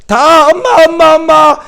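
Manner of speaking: put-on high, loud repeated childish wail; child crying for mom. Preacher playing a sobbing child clinging to mom, wailing. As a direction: call mom over and over like a sobbing kid.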